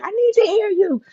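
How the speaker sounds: high-pitched retold plea; imitated urgent high whine; pleading demand. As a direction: squeal it like you urgently need an answer. Retold demand during labour.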